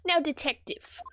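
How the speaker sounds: lightly British prosecutor; British accent. Acted. Prosecutor addressing the detective, British accent restored. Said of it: "got really southern there".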